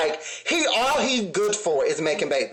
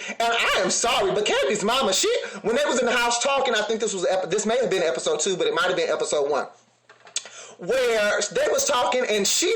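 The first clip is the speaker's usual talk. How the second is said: normal talking voice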